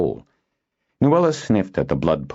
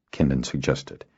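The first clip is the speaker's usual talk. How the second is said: ordinary delivery, nothing marked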